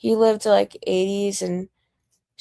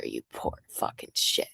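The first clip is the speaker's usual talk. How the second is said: whispered insult; 10 dB quieter than usual. Real, not acted. Whispered insult to chat troll.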